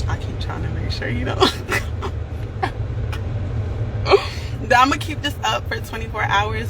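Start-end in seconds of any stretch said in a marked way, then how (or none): 1.4-4.7 high, mostly voiceless laugh; long breathy crack-up; real laughing fit. Real, not acted. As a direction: lose it laughing for a few seconds. Cracks up mid-explanation.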